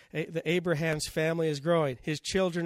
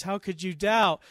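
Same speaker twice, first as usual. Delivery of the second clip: ordinary talk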